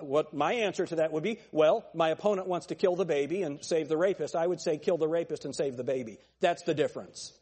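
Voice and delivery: ordinary talk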